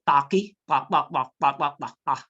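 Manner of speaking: repeated pa syllables to show the hard Hausa sound; Hausa sound demo. Shown as a demonstration. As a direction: drill the syllable pa, pa, pa clearly. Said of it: "there is a sound, for example".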